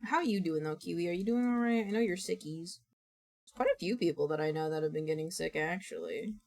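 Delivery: ordinary talk, nothing marked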